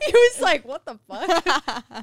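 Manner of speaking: loud, high, through laughter; laughing. Real, not acted. Telling partner's baffled reaction, laughing. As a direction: crack up while telling it.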